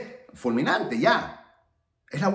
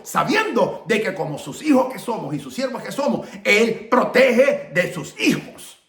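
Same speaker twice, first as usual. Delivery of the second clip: rolling list building to an emphatic finish; impassioned preaching; 4 semitones higher, 4 dB louder than usual. Acted. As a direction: preach it with rising conviction. Preaches to the congregation.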